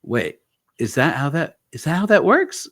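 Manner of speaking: acting out a startled double-check; retold astonished question. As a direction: pitch leaps up in puzzled surprise.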